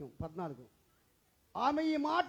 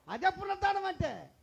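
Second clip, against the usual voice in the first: higher and louder, preached out; proclaiming; 7 semitones higher, 4 dB louder than usual. Acted. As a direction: ring it out to the congregation. Repeated key line, now proclaimed.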